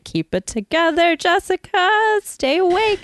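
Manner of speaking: high, strained mock pep talk; will yourself awake in a high voice; urgent self-talk. Retold self-talk fighting off sleep.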